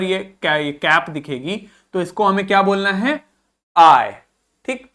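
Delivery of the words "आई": आई as one long "eye" sound; rhymes with high. Shown as a demonstration. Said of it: "To rhyme with high".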